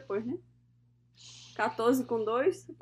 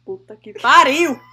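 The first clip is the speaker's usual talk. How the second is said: exasperated outburst; 11 dB louder, slower than usual. Real, not acted.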